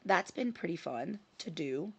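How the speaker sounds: ordinary talk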